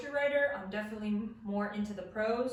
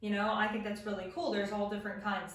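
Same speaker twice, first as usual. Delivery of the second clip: ordinary talk, nothing marked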